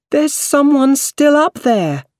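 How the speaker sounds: high, loud, slow shout for help. A narrator's reading. Woman in the street shouting to Spider-Man, high and loud. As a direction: shout up to the floor above. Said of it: "a woman shouted".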